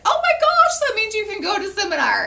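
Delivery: very high, loud mock squeal; excited retold reaction. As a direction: imitate a director bursting with excitement. Acting out director's excited reaction.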